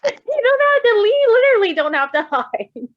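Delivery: high, loud, breathless delight; squealing fond reassurance; excited high pleading. Real, not acted. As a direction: beg him not to hide, amused. Laughing at the character's reaction.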